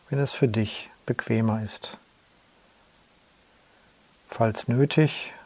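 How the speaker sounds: usual speaking voice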